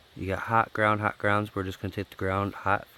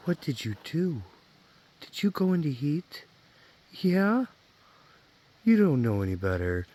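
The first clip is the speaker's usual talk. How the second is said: high teasing dog talk; 5 semitones higher, 7 dB louder than usual. Real, not acted. Teasing dog about heat.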